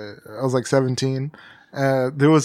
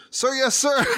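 scared recruit; 13 semitones higher than usual. Retold or imitated. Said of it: "the guy goes".

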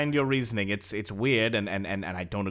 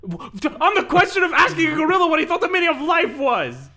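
very high, loud comic rant; high-pitched incredulous yelling; exasperated outburst; 6 dB louder than usual. Acted. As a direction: blurt it out at full pitch in disbelief.

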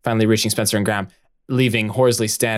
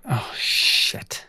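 under breath; 3 dB quieter than usual. Acted. Harriet realizing, under breath.

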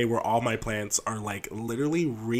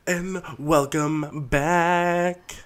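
drawn out and higher; slow sing-song welcome; 6 semitones higher, 3 dB louder, much slower than usual. Acted. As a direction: stretch the greeting out. Drawn-out show greeting.

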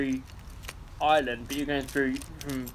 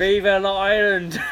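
mock patriotic cheer; 4 semitones higher, 7 dB louder than usual.

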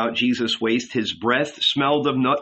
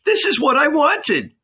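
much higher, louder cry of joy; ecstatic shout; 14 semitones higher, 3 dB louder than usual. A narrator's reading. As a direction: shout your thanks up to heaven. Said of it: "lifted her hands to heaven and shouted".

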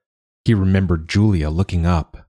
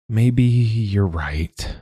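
slow and thoughtful, higher; 4 semitones higher, much slower than usual. Acted. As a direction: turn it over slowly in your mind. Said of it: "Julia says slowly".

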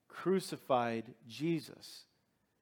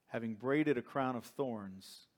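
ordinary talk, nothing marked

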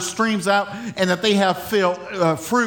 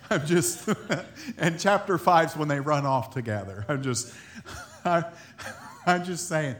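words broken by laughter, softer; giggling at own joke; laughing; 2 semitones lower, 7 dB quieter than usual. Real, not acted.

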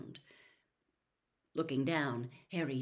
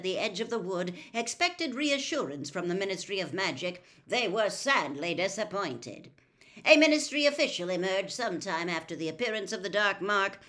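news read; 3 semitones higher, 6 dB louder than usual. A narrator's reading. Reads newspaper article aloud.

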